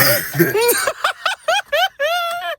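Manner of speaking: squealing high-pitched laugh. Real, not acted. Laughing at partner spitting on him, high squeal. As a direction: burst into a shrill squeal of a laugh.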